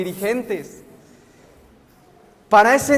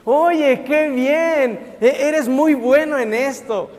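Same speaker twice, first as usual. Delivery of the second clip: higher, drawn-out admiring praise; gushing flatterer; 3 semitones higher, slower than usual. Acted. People praising you after you do something well, gushing. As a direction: lay on the compliments in a raised, lilting voice. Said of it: "van a decirte".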